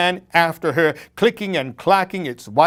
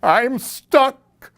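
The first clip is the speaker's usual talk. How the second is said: higher, louder frightened cry; scared cry; 9 semitones higher, 4 dB louder than usual. A narrator's reading. Skeleton stuck under the bed cries out in fear. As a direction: cry out, trapped. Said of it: "cried the skeleton".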